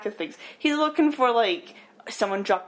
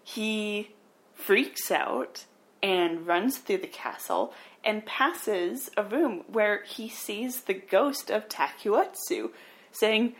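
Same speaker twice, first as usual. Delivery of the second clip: normal talking voice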